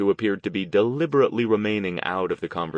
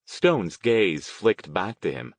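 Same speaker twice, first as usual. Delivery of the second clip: ordinary delivery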